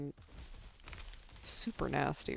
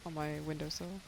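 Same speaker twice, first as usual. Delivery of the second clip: normal talking voice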